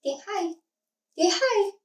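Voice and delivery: high, coaxing pet voice; lifted sing-song voice for a dog. Real, not acted. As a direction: sweet-talk the puppy into saying hi. Coaxing the dog to greet viewers.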